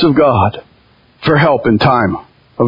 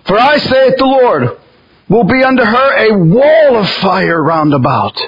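higher, solemn proclaiming of the verse; scripture reading; 4 semitones higher than usual. Acted. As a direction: declare the Lord's words. Reads scripture aloud.

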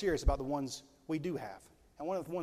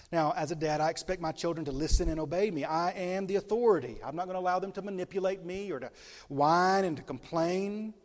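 firm, raised preacher's voice; preaching; 2 semitones higher, 5 dB louder than usual. Acted. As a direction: lay down the law from the pulpit. Preaching at full force.

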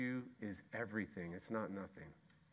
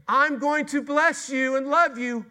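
very high and loud, swelling on love; a soaring divine promise; God's loving promise; 14 dB louder than usual. Acted. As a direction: proclaim it like God pouring out love. God's promise against grumbling.

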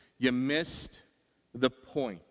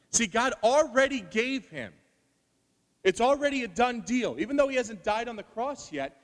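loud, driving sermon climax; fiery preaching; 6 semitones higher, 7 dB louder than usual. Acted. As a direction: preach it hard to the congregation.

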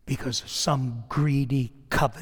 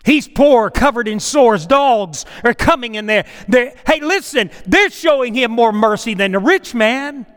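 loud, high, driving sermon delivery; full-force preaching; 7 semitones higher, 9 dB louder than usual. Acted. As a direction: preach it hard to the congregation, voice climbing. Preacher rising again on Lazarus.

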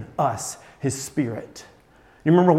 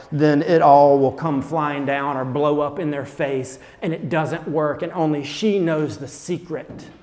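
normal talking voice

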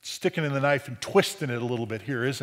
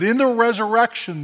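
higher, louder, with exaggerated knowing emphasis; knowing mock quote; 6 semitones higher, 5 dB louder than usual. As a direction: quote it with a verbal wink and nudge. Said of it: "wink, wink, nudge, nudge".

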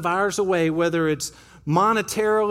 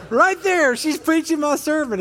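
excited exclaim; 7 semitones higher than usual. Retold or imitated. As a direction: burst out delighted at the kid. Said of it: "And I was like".

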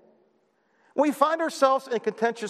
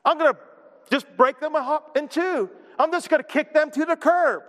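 harsh scornful judge; 7 semitones higher than usual. Retold or imitated. Preacher imitating a judge rejecting bruised people, mock harsh. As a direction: sneer and bark out the rejection. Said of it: "he's not gonna say".

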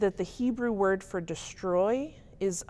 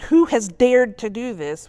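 loud, furious demand; angry king; 4 semitones higher, 11 dB louder than usual. Shown as a demonstration. Teacher acting out King Ahasuerus's furious question, loud anger. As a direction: roar the question like an enraged king. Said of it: "You can't really say ... without being angry".

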